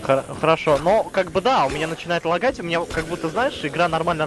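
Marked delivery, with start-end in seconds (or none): none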